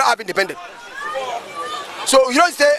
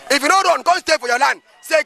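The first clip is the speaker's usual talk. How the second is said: ordinary talk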